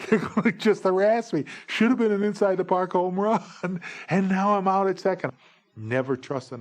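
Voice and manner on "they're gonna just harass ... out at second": high, loud mock whine; hammed-up aggrieved wail; wailing complaint. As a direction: lament it like a wronged ballplayer. Runner whines about being called out.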